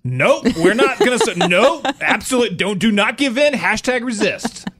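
high, loud mock-outraged protester; shrill up the pitch and yell it like a caricature activist; mocking the left. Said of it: "the left would be like".

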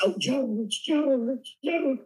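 high lovestruck sighing, climbing with each name; mock swooning. Actor with a crush fawning over handsome co-star, mock swooning. Had a crush on George Clooney.

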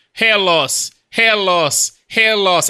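loud, high Australian announcer repeating the phrase; mock Australian ad guy, punchy and loud. Retelling a funny Australian ad, loud Australian announcer voice. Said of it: "because of the tone of voice".

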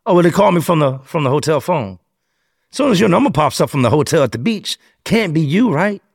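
high-pitched mock-puzzled questioning; sarcastic detective. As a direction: imitate a detective springing a trap with feigned innocence. Detective traps him with logic.

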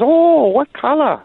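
high, slow, odd mock caller; prank caller voice. Caller asks the sportscaster what color his boots are, high mock voice. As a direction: draw out the caller's eager question. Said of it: "And he goes".

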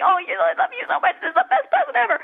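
very high, fast, laughing gush of love; gushing child. Retold or imitated. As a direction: squeal it like an overjoyed kid. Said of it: "and like".

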